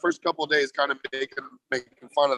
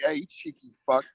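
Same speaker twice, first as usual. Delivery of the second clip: higher-pitched mock British insult; cheeky fake British jab; bad British accent; 8 semitones higher than usual. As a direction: put on a dumb, hammy British accent. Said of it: "When I try to do the British accent".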